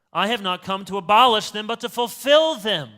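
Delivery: loud, ringing declaration, stress on abolish and fulfill; grand proclamation. Retold or imitated. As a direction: proclaim it like a solemn pronouncement. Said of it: "Who talks like this?".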